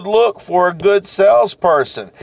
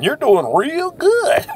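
laughing; 5 semitones higher, slower than usual. Real, not acted. Teasing aside with a laugh.

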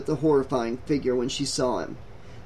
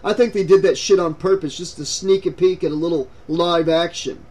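mildly raised, angry voice; yelling; 4 dB louder than usual. A narrator's reading. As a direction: gripe loudly, but hold back the yell. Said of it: "Brandon yelled".

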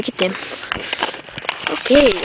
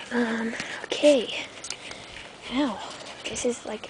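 whispering; 12 dB quieter, slower than usual. Real, not acted. Secret whisper continues.

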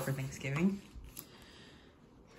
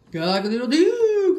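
loud, high, drawn-out crow; crowing rooster; 8 semitones higher, 10 dB louder, slower than usual. A narrator's reading. Rooster greeting Turkey in a sheep costume, crowing. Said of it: "crowed Rooster".

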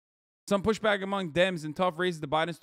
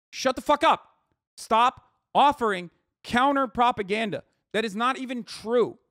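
louder, punched-out demands; angry rant; 4 semitones higher, 5 dB louder than usual. Real, not acted. Streamer tells Democrats to stop offering counter propaganda, angry. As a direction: tell them off hard, word by word.

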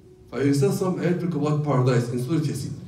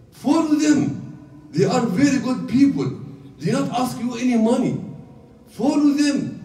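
pleading call; 8 semitones higher than usual. Acted. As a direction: urge the townspeople to follow. The man calling to his people.

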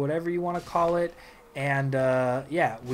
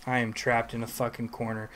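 ordinary delivery

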